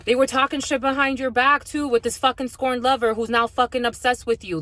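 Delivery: usual speaking voice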